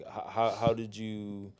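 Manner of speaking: normal talking voice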